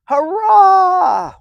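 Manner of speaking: high, loud joyful cheer. A narrator's reading. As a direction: burst out with a happy hurrah. Rescuer appears in helicopter.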